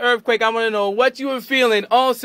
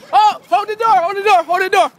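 raised, higher-pitched urgent call; 8 semitones higher, 4 dB louder than usual. Real, not acted. Radio host calling for the door after an earthquake, urgent.

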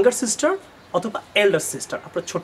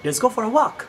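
offering voice; 5 semitones higher, slower than usual. Shown as a demonstration. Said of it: "তখন আপনি offer করবেন".

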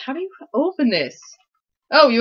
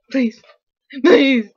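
rising to a loud plea; loud pleading; 3 semitones higher, 7 dB louder than usual. Real, not acted. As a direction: beg loudly now. Begging loudly after soft.

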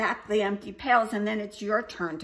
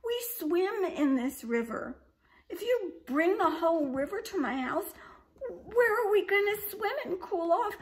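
much higher, louder whimpering plea; pleading baby dragon; 11 semitones higher, 6 dB louder than usual. A narrator's reading. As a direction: beg her, half crying. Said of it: "said the baby dragon".

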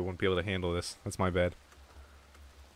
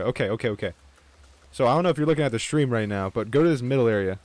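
ordinary talk

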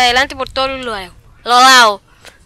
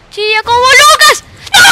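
shouted taunt; 10 semitones higher, 8 dB louder than usual. Real, not acted. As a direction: belt out "Chilla como Lucas".

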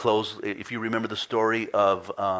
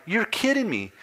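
higher, sharp exasperated retort; frustrated disbelief; 12 semitones higher, 3 dB louder than usual. Retold or imitated. Customer retelling his annoyed reply to the rental agent. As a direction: let the irritation spill out. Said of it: "I just got a little frustrated".

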